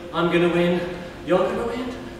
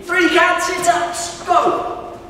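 calling out; 9 semitones higher, 3 dB louder, slower than usual. Real, not acted. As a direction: call the race start loudly. Calling out race commands.

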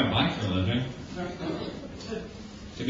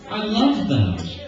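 mock polite gushing; 5 semitones higher, much slower than usual. Filter on, polite shoe praise.

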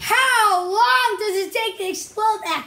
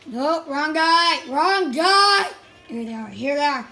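ordinary talk, nothing marked

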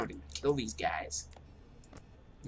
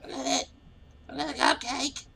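squeaky voice; 13 semitones higher, 4 dB louder than usual. Acted. High squeaky voice at cupcake.

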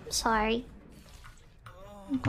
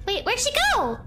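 ordinary delivery

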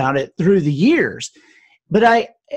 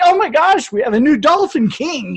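retold excited gasp, pitched higher; amazed kids; 8 semitones higher than usual. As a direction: relive the kids' amazement at the new dolphin king. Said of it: "And we're like".

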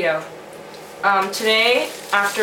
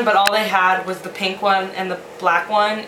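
usual speaking voice

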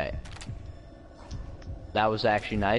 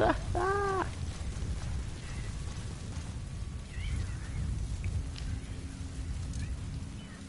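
high, breathy laugh trailing off; long laugh; 7 dB quieter than usual. Real, not acted. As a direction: laugh until the breath runs out. Laughing after the save.

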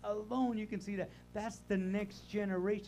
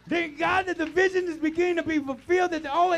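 loud, high, driving preacher's cry; full-force preaching; 11 semitones higher, 11 dB louder than usual. Acted. Pastor preaching hard to the congregation, full force. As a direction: preach it out at the top of the lungs.

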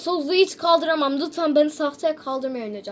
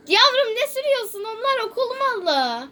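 high-pitched exasperated telling-off; teacher's scolding; 7 semitones higher than usual. Acted. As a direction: scold a pupil, voice jumping up. Öğretmen öğrenciyi azarlıyor. (azarlayan öğretmen)

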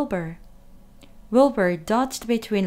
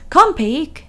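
higher, louder coaxing call; calling the pig; 6 semitones higher, 8 dB louder than usual. A narrator's reading. Farmer calling his pig, high and loud. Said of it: "said Mister Zuckerman".

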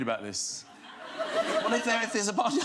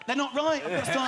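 fed-up wife; 8 semitones higher, 3 dB louder than usual. Retold or imitated. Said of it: "look in the mirror and go".

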